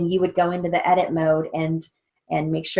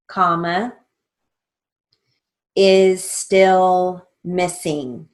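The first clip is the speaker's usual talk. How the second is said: slow, deliberate dictation read with pauses; 4 dB louder, much slower than usual. Shown as a demonstration. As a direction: dictate each word slowly and clearly for the class. Dictation with spelled name.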